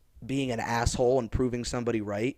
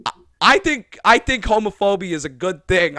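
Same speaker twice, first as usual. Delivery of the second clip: higher and louder, mock earnest; mock absurd statement; 4 semitones higher, 8 dB louder than usual. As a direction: say something outrageous as a joke. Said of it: "looked at you and went".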